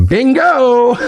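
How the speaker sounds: high, gleeful burst; gleeful exclamation. Real, not acted. Streamer answering a cheeky chat message, gleeful. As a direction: crow it, breaking into a laugh. Reacts gleefully to chat's joke.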